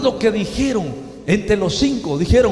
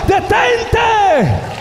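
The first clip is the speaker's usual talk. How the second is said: booming, high, loud command; thunderous voice; 13 semitones higher, 4 dB louder than usual. Retold or imitated. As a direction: thunder it down from heaven. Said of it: "una voz tronante dijo". (voz tronante que ordena)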